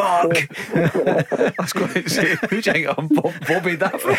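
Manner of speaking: talking through real laughter; can't keep a straight face; laughing. Real, not acted. Pundit cracks up at the host's joke, laughing through words.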